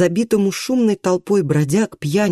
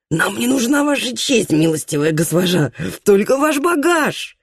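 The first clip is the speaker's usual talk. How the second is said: mild shout, higher pitched; 3 semitones higher than usual. Acted. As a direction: call out mockingly while chasing. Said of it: "крикнул один из них".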